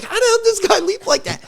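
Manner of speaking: fast, high-pitched amazement; amazed. Retold or imitated. Watching his dad leap the fence, amazed. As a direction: blurt it in disbelief.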